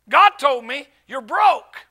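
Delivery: slow, emphatic delivery of a revelation; retold bombshell, punched out; imitated pronouncement. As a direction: announce it slowly, leaning on GOD and BROKE. Voices the man's revelation.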